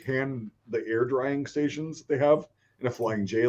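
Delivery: ordinary delivery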